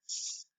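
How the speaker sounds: "s" as an isolated hiss; soft C. Shown as a demonstration. Teacher showing soft C, a lone "s" sound. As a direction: sound out "s", not the letter name. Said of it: "a soft sound".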